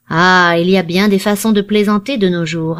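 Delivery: usual speaking voice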